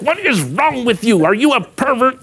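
high-pitched, hammed-up outraged mother; mock angry scolding. Mom grabbing her son by the neck, mock furious scolding.

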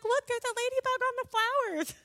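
very high-pitched imitated amazement; excited squeal. Decor helper showing her a ladybug, high excited squeal. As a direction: squeal like a friend who spotted a sign.

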